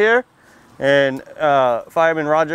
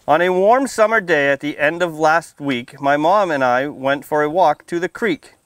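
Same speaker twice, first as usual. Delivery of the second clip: put-on lively storybook read-aloud for little kids; reading to children; 2 semitones higher, slower than usual. A narrator's reading. Firefighter reading a picture book to kids at story time, lively read-aloud. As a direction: read it out bright and clear for the children.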